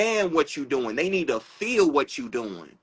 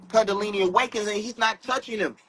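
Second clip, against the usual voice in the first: ordinary talk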